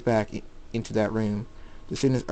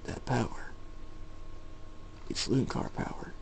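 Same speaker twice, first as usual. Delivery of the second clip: very soft, voiceless murmur; inner thought; 10 dB quieter than usual. A narrator's reading. Old man realising whose power it is, hushed thought. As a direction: think it aloud under your breath. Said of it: "The old man thinks".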